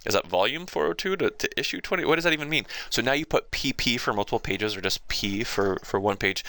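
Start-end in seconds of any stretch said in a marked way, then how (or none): 0.0-2.6 a hint of a puzzled reader, higher; mock confused asking. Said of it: "it's like".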